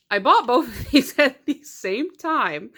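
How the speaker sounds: slow, laughing admission; laughing confession. Real, not acted. Laughing confession of duplicate buy.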